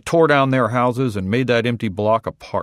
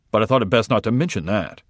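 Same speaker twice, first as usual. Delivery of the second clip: plain reading voice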